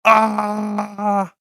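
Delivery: long drawn-out attack cry; acted "aaah" war cry; battle yell. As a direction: yell it out as you lunge.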